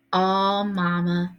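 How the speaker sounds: understated dismay, drawn in a Southern way; a mild, let-down Southern sigh of words; upset and disappointed. A narrator's reading. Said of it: "sounded real upset and disappointed in Southern".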